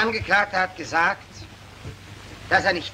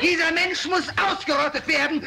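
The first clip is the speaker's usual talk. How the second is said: each sentence higher, hammering home; rising demand; 6 semitones higher than usual. Acted. Prosecutor to the mob, rising fierce demand. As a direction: whip up the crowd.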